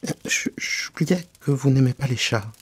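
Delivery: falter, then excuse yourself; hesitant apology. Acted.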